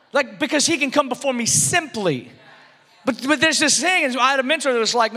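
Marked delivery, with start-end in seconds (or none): none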